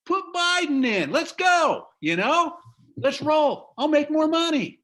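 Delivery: high, loud, hammed-up rallying cry; mock cheer. Joking about profits under Biden, mock cheering. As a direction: cheer it on like a sarcastic fan.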